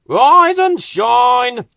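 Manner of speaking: slow, loud, high wake-up shout; loud slow sing-song wake-up call. Acted. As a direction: stretch it out to rouse the sleepers. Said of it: "he called".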